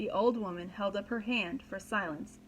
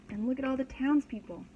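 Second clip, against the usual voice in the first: usual speaking voice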